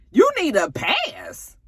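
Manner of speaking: very high, slow disbelief; mock incredulous wife. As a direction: drag it out high, not believing it. [name] repeating his words in disbelief.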